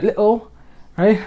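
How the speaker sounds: imitated British little, t swallowed; glottal-stop little, UK style; UK accent. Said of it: "U Kers, they go".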